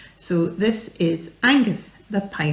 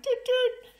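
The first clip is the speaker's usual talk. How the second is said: very high horn imitation; high tooting horn noise. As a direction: toot like a car horn. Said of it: "tooted on the horn".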